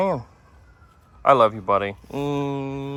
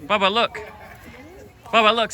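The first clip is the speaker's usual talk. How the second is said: calling out; 9 semitones higher than usual. Real, not acted. Calling toddler to camera.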